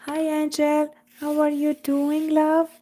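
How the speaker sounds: normal talking voice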